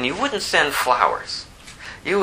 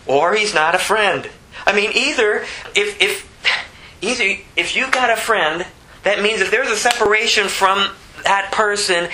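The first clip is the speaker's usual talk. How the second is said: insistent, pitched-up preacher; impassioned preaching; 8 semitones higher than usual. Real, not acted. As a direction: argue it fervently on a raised pitch. Preaches with rising force.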